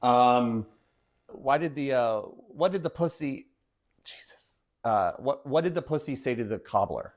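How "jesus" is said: unvoiced under-breath whisper; whispered aside. Real, not acted. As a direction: whisper it to yourself. Muttered aside to self.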